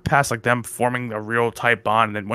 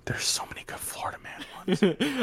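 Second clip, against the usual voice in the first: whisper; 8 semitones higher, 9 dB quieter than usual. Real, not acted. Whispered aside about Florida stories.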